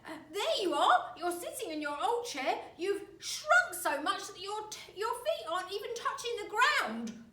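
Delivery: put-on high, loud, cackling old man; gloating shout. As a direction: shout it in triumph. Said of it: "pointed at her and shouted".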